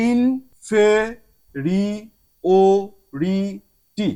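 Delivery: ইনফেরিওরিটি stretched out very slowly; teacher drawing out ইনফেরিওরিটি; slow demo word. Shown as a demonstration. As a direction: model ইনফেরিওরিটি syllable by syllable. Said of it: "খেয়াল করলে দেখবেন".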